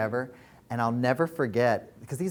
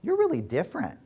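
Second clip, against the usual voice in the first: much higher, astonished; old friend voicing surprise, higher and amazed; 13 semitones higher than usual. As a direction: marvel at how changed she is. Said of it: "she's like".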